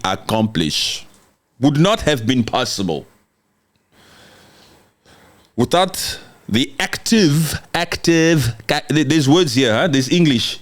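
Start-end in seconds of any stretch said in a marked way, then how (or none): 5.6-8.5 "active" drawn out slowly, stressed each time; deliberate, drawn-out "active" to make the word count; emphasis on active. Real, not acted. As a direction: lean hard and slow on "active". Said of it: "listen to every freaking word".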